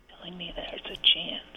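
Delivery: hushed, whispered pondering; whispering to oneself. Real, not acted. Caller puzzling over a movie quote, whispering to herself. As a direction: repeat it under your breath, thinking.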